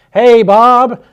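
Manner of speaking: loud, high chorus hello; mock group greeting. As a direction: call it out like the whole room at once. Whole room greeting Bob in chorus.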